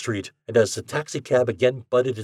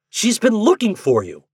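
protesting; 11 semitones higher, 6 dB louder than usual. A narrator's reading. Ford protesting.

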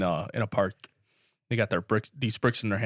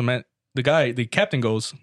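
normal talking voice

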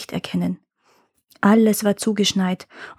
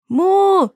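high, loud imitated moo; loud cow moo; 10 semitones higher, 5 dB louder than usual. A narrator's reading. Cow refuses the farmer's coaxing, loud high moo. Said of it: "schrie Bella".

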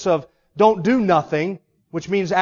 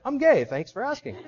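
smiling reply; 5 semitones higher than usual. Retold or imitated. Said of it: "you smile big and say".

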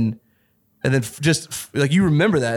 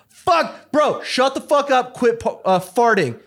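bro voice; 12 semitones higher, slower than usual. Pretend. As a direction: yell at your buddies, rowdy. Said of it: "I can flip that voice on immediately".

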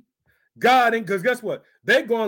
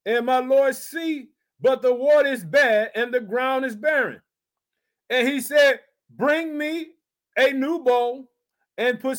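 raised, ringing preacher's reading of scripture; 4 semitones higher than usual. Acted. Preacher reads scripture to his congregation, raised and ringing. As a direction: proclaim the verse to the church.

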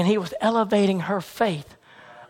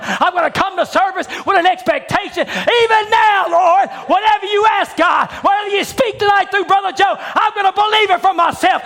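builds from steady preaching to a loud, high shout; rising preaching shout; 7 semitones higher, 4 dB louder than usual. Acted. Preacher lifting the church toward full force.

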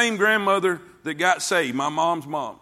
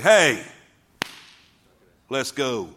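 sharp call; 4 semitones lower, slower than usual. Retold or imitated. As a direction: snap them awake. Quoting a rousing call.

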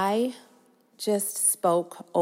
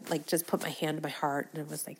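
ordinary talk, nothing marked

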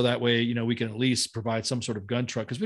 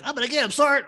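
mock eager kid voice, much higher; a keen little soldier, pitched way up; cute eager young medic; 12 semitones higher, 3 dB louder than usual. Imitating an eager medic to his sergeant. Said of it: "he was so cute".

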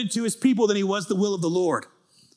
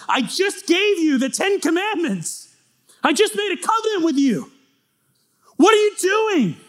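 high, rising outrage, loudest at the end; a betrayed God venting his anger; angry God; 13 semitones higher, 4 dB louder than usual. Retold or imitated. God, furious at his people, voiced high and loud. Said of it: "his anger starts to burn".

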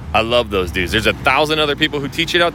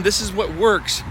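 higher, slow, mocking guru voice; higher and slow, parroting the top realtors; mock marketer hype; 8 semitones higher than usual. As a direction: send up the confident marketing pitch. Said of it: "top realtors were saying".

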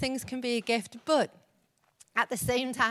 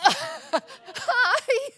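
high, startled little hi; a squeaky, awkward hi from someone put on the spot; frozen awkward hi; 13 semitones higher, 8 dB louder than usual. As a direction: blurt a caught-off-guard hi. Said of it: "I just, I froze".

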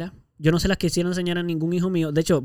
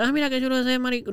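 higher, sneering quote of a bully; mocking taunt; 7 semitones higher than usual. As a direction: toss the insult off lightly, lightly voiced. Imita a alguien que insulta. (burla imitada, tono alto y despectivo)

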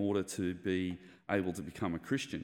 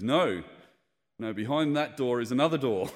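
preacher correcting; 3 semitones higher, 6 dB louder than usual. Retold or imitated. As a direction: correct the answer with a loud punchline. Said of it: "He goes".

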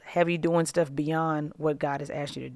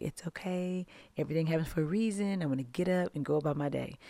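mock zen calm; 7 dB quieter than usual. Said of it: "super zen and just like".

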